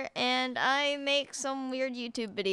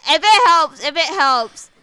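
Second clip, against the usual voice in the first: louder, eager interjection; 4 semitones higher, 12 dB louder than usual. Real, not acted. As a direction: cut in loudly. Loud laughing interjection.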